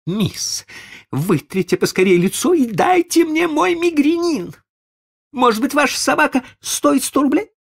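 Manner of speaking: high, loud frantic lady; hysterical lady. Acted. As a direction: rant like a rich lady losing patience.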